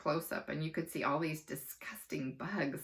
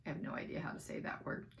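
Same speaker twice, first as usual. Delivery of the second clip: fast, whispered aside; quiet aside; 9 dB quieter, faster than usual. A narrator's reading. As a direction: mutter it quickly to the listener. Narrator's quick aside.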